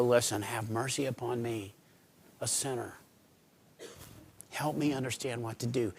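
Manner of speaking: soft, pleading prayer; soft humble plea to God; quiet prayer. Acted. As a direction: hush down and plead for mercy.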